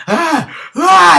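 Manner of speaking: high, loud imitated scream; mock scream. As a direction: yelp out a mock cry of pain. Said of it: "he starts screaming".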